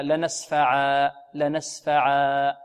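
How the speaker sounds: لنسفعا said slowly, drawn out and stressed on each syllable; pause form shown. Shown as a demonstration. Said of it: "نقف عليه هكذا".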